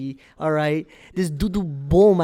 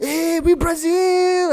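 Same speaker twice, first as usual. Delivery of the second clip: high, drawn-out celebratory chant; mock victory holler; taunting cheer; 10 semitones higher, 3 dB louder, much slower than usual. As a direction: stretch it out like a gloating team cheer. Mimicking the Brazilians' cheer.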